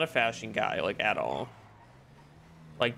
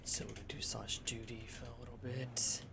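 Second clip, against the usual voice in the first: whispering; 16 dB quieter than usual. Real, not acted. Quiet aside while driving in.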